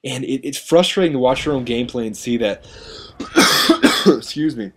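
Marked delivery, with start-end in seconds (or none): 2.9-4.0 sharp dry cough; a sudden hard cough. Real, not acted. Cough mid-commentary.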